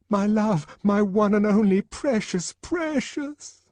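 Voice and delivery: high, gushing endearments; high, swooning lover cooing precious; doting lover. A narrator's reading. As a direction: pour it out like a besotted lover.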